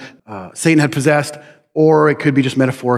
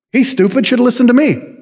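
higher, jeering told-you-so; mocking taunt; 8 semitones higher than usual. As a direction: taunt the fool who didn't listen. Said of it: "wisdom later then mocks them".

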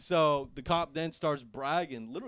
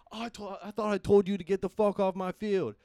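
higher, louder mock cop bark; angry cop; 6 semitones higher, 5 dB louder than usual. Cop scolding them for still being on the field, loud and high. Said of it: "He's like".

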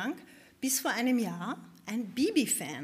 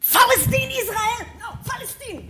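viel höher und lauter herausgeschrien; brüll die Worte wie ein wütender Jogger; wütendes Brüllen; 12 semitones higher, 9 dB louder than usual. Retold or imitated. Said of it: "brüllt mich an". (der wütende Jogger brüllt sie an, sehr laut und hoch)

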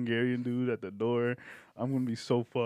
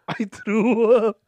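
laughing; 9 semitones higher, 15 dB louder, much slower than usual. Real, not acted. Repeats laughing.

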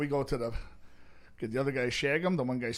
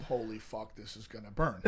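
pained mutter; 6 semitones lower, 8 dB quieter than usual. Retold or imitated. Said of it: "I'm going".

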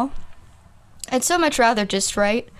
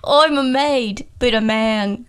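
Michael Caine. Acted. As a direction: draw it out in a British impersonation. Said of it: "I think it's Michael Caine".

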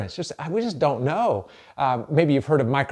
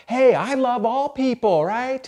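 higher, slow, breezy churchgoer voice; mock rhetoric; 11 semitones higher, 4 dB louder, slower than usual. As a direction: lampoon the easy slogan. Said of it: "all the rhetoric".

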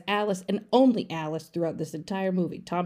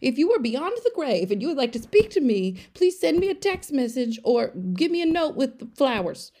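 exasperated rant; 6 semitones higher, 4 dB louder than usual. Real, not acted. Ranting plea to ghosts.

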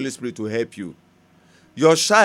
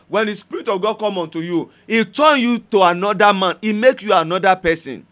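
preacher thundering the verse; forceful preaching; 5 semitones higher, 5 dB louder than usual. Acted. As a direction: proclaim it to the congregation.

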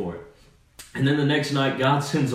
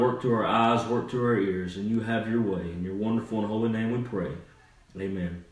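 low, softened pastor's prayer; 3 semitones lower than usual. Real, not acted. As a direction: lead the congregation gently in prayer. Leads a prayer.

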